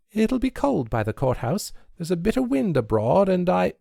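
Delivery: lightly voiced lass fibbing; girl making excuses. A narrator's reading.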